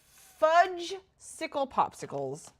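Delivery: drawn-out, high, loud substitute curse; mock swearing. Real, not acted. Player has no agate, mock swearing in frustration. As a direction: stretch out the silly swear in frustration.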